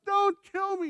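high, loud panicked pleading; begging, crying. Retold or imitated. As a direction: beg for your life in a high cracking voice. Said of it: "and he's going".

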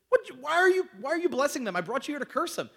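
high, loud indignant outburst, imitating the king; mock outraged king. King Balak yelling at Balaam for blessing Israel, mock furious high shout. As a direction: throw up your hands, why are you blessing them.

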